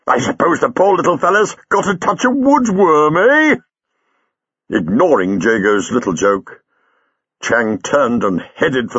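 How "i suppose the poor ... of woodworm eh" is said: high, gleeful teasing; chuckling. Acted. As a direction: voice pitched way up with mirth. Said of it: "Jago chuckled".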